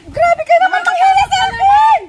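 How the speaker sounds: high, loud outraged complaint; shouting. Real, not acted. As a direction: yell it out, fed up. Victim complains loudly.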